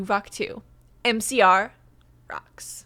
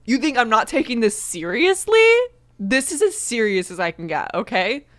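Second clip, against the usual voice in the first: mock indignation; 7 dB louder than usual. Defending their fan expertise to viewers, mock indignation. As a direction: act jokingly offended, pushing the voice.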